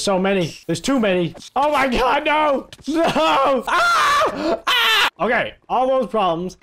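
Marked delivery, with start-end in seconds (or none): 1.6-3.6 high-pitched, drawn-out panic; panicked cry. Real, not acted. Overwhelmed by enemies in game. 3.7-5.1 very high, loud scream; panicked scream. Real, not acted. Screaming as enemies attack.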